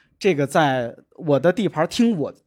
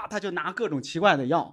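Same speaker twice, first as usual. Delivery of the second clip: ordinary talk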